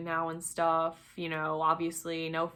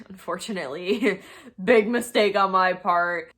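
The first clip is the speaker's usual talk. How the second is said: rueful laugh; 7 dB louder than usual. Real, not acted. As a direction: laugh it off as a big mistake. Laughing at her own mistake.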